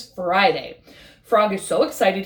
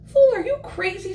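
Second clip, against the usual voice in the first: high, scornful jeer; mocking friend; 9 semitones higher than usual. Pond friend jeers at Frog's dream, high and scornful. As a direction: laugh him down as a fool.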